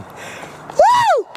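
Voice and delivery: loud high victory whoop; joyful whoop. Real, not acted. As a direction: let out a wild cheer. Cheer after the shot.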